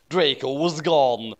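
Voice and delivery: usual speaking voice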